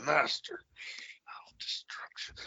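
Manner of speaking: soft, slow whispered threat; mock menacing whisper. Cohost echoes Sid's catchphrase, slow eerie whisper. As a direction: breathe it like a secret villain line.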